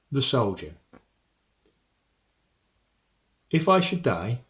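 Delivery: measured poetry reading, pausing after each phrase; poem recitation. Acted. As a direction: recite it solemnly, line by line, with weighted pauses. Recites the sonnet to students.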